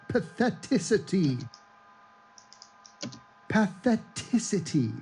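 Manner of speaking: stumbling over patheticity, syllable by syllable; fumbling a made-up word. Shown as a demonstration. Trying to coin a word for pathetic people, stumbling. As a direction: sound out patheticity slowly, trying again and again. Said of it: "It's not a word".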